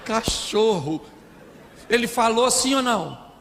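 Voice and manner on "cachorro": mock sobbing, words drawn out slowly; hammed-up tearful name-calling; imitated wife's insult. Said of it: "E ela fala".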